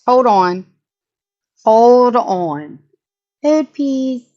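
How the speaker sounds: loud, rising call to stop everything; loud hold on. Real, not acted. Stopping to react to comment.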